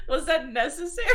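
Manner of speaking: high, loud questioning protest; mild mock reproach. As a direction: call him out, half laughing. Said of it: "turns her head towards Galen".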